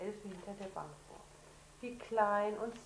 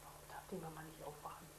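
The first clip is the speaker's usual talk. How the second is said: fast whispered question; 3 semitones lower, 11 dB quieter, faster than usual. Real, not acted. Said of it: "whispered question to child". (geflüstert)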